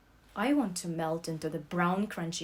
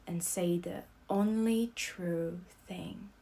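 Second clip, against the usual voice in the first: ordinary delivery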